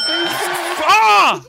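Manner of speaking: sudden loud high shout; yelling. Real, not acted. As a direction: let out a sharp yell. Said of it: "Sorry for yelling so loud".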